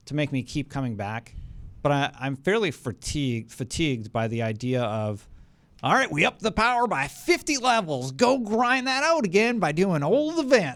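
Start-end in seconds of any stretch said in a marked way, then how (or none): 5.8-10.8 put-on high, loud old prospector voice; old-timer miner voice. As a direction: play a grizzled old miner griping. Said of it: "I'm doing the old-timer miner voice".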